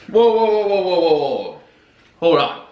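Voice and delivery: loud, high whoas tumbling down; rapid-fire whoas, falling in pitch to a stop; mock alarm. Host halts his own explanation in mock alarm.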